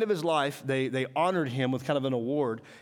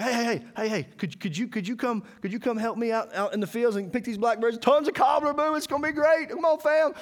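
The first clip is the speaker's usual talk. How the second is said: high-pitched eager pitch, building to loud hype; rally the family with mounting excitement; stammering plea rising into a hammed-up cheer; excited recruiting; 9 semitones higher, 4 dB louder than usual. Hyping helpers to pick berries.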